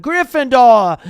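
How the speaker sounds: loud proud announcement; shouted. A narrator's reading. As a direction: ring it out across the hall. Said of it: "the hat had shouted".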